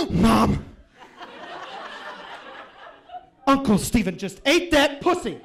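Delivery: put-on high, loud kid yell, laughing; toddler shout. Nephew shouts to his mom on the phone, loud toddler yell. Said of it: "and he goes".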